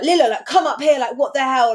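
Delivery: retold calling out. Host retelling calling Lila upstairs, loud urgent shout. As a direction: reenact yelling down to another floor.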